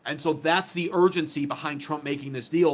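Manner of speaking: ordinary talk, nothing marked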